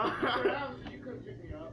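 mock whine. Friend whining he wasn't picked up, mock cry. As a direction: fake crying whine, high-pitched.

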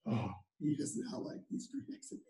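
breathy whispered aside to the listener; hushed aside, unvoiced. Real, not acted.

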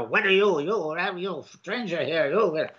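a hint of a suspicious old storekeeper grumbling; grumpy storekeeper. Reviewer imitating the storekeeper barking at a stranger, mildly grouchy. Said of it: "a curmudgeonly storekeeper".

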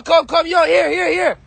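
ordinary talk, nothing marked

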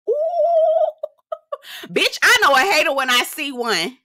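squealing laugh into a sassy line. Retold or imitated. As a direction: cackle then deliver the read. Mocking line with big laugh.

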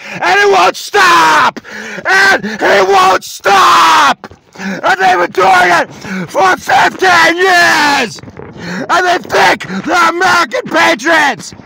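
real high, drawn-out full-force scream; screaming outburst. Real, not acted. Man demanding to be heard after years of attacks, screaming.

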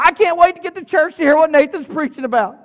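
high and loud, eager to get to church; loud, high and excited; eager churchgoer. Pretend. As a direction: sound like you can't wait to get there. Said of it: "this morning go".

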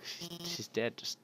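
hushed shocked whisper. Real, not acted. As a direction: breathe it in disbelief. Shocked discovery mid-game.

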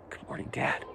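barely audible, tender whisper; hushed, intimate answer to a father; whispered reply. Acted. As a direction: whisper it back as the son. Imagined son's reply to father.

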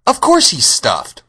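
high, loud, slow indignant reply; cross girl stretching out the obvious; still angry. A narrator's reading. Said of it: "who was still angry".

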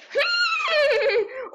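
high shrieking laughter. Real, not acted. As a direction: burst out cackling.

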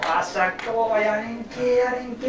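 lightly voiced feeble old man; soft, weak old voice; creaky wise old man. The fake-sick man begging off.

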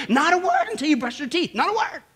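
NOT A WORD pitched high and insistent; emphatic warning. Acted. Mother advising the bride-to-be, emphatic warning. Mother voiced giving firm advice.

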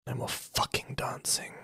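slow, whispered scolding; stern whisper. Acted. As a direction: whisper it like a threat.

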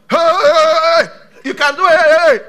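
high, very loud repeated cries; mock frenzied shouting. Preacher mocking noise where God is absent, frenzied shouting. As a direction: whoop it up like a noisy show. Mimics noisy drama without God.

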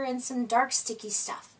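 ordinary delivery

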